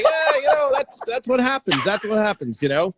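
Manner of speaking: high, lightly dismissive shrug; mock shrugging manager. Manager shrugging off the incident, mock casual. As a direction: toss it off like it's normal. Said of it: "it's like".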